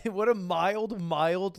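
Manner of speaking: ordinary talk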